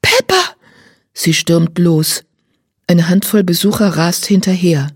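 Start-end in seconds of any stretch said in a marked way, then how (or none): 0.0-0.6 a much higher cry of alarm; a girl cries out for her missing horse; anguished yelp. A narrator's reading. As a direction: howl the name in fright. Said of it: "Pia jault auf".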